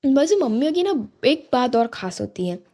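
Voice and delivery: usual speaking voice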